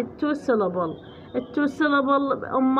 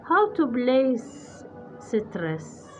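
slow text reading; 4 dB quieter, much slower than usual. Shown as a demonstration. Slowly reads the English text.

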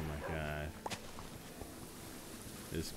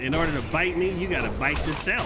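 raised, high mocking taunt; loud taunt; 6 semitones higher, 6 dB louder, faster than usual. Real, not acted. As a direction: shout the taunt at it.

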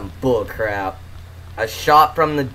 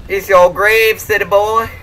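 high-pitched mock Texan; Texan accent; 6 semitones higher, slower than usual. Gamer repeating his joke to his teammate, Texan accent.

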